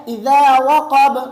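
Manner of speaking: "اذا" with tongue under front teeth, slow and loud; tongue-tip dhal. Shown as a demonstration. Teacher shows tongue placement, loud slow dhal. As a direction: chant "اذا وقب" slowly with dh, not z. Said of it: "زبان کے نوک کو سامنے والے دانتوں کے نیچے".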